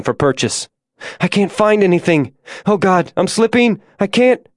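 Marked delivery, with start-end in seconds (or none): 1.2-4.5 high-pitched, climbing toward desperation; rising panic. A narrator's reading. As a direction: panic mounts as your grip slips. Climber losing footing, panicking.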